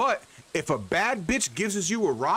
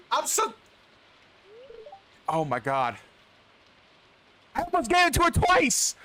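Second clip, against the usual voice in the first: frantic apology; 10 semitones higher than usual. Real, not acted. Apologizing to game wife.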